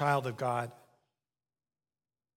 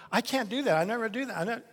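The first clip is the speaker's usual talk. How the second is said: whiny excuse; 7 semitones higher, 3 dB louder, faster than usual. Acted. Believer refusing God.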